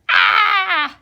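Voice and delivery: loud high shriek of horror; scream. Acted. Oliver sees his hair and lets out a loud high shriek. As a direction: shriek at the mirror.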